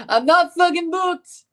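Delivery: high, slow, badly faked Scottish accent; a botched, high Scottish brogue. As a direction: drag out a terrible Scottish impression. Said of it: "That was horrible".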